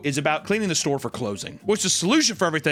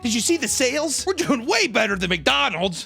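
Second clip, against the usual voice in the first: high-pitched put-on employee chatter; hammed-up excited boasting; mock gossip; 8 semitones higher than usual. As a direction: pitch it up like an over-eager coworker. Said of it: "as if people are like".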